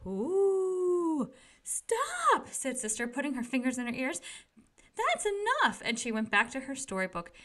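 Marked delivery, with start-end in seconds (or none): 0.1-1.3 high, eerie, long ooh; spooky cave wail. A narrator's reading. Brother reads the crying cave to scare Sister, hollow wail. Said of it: "read Brother with a lot of expression". 1.6-2.5 high, sharp cry of stop; frightened stop. Acted. Sister begs Brother to stop the scary story, high pleading cry. 5.0-5.8 high, sharp cry of stop; frightened stop. Acted. Sister begs Brother to stop the scary story, high pleading cry.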